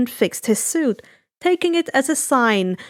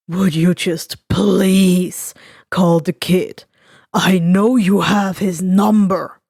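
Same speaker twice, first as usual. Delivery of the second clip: low, louder, slow gruff villain insisting; Dabi pushing; 6 semitones lower, 4 dB louder, slower than usual. Acted. Dabi pushing Shigaraki to call the kid, low and insistent. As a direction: lean on him, low and heavy, to make the call.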